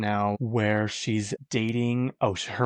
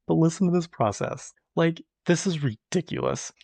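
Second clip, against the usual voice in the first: pitched up high in disbelief; exasperated; 7 semitones higher than usual. Real, not acted. Vlogger complaining about SNL standby hoops, high and exasperated. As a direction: complain that it's absurd. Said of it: "complaining about ticket process".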